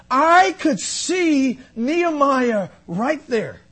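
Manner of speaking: high, drawn-out testimony; impassioned preaching. Real, not acted. Preacher confesses his disobedience to the church, impassioned preaching. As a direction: preach it with weight on every phrase.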